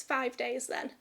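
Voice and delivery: normal talking voice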